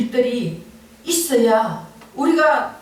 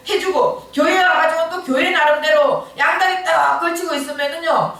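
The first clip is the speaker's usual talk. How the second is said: usual speaking voice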